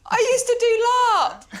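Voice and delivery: high, loud, drawn-out exclamation; excited outburst. Real, not acted. Guest hearing of a shared hobby, excited outburst. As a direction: blurt it out loudly, stretching each word.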